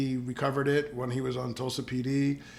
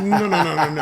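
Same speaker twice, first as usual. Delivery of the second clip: higher, louder rapid no's; insistent refusal; 4 semitones higher, 8 dB louder than usual. Retold or imitated. Collector refuses to leave jacket at cleaner: higher, louder insistent no's. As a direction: refuse it outright, won't part with it.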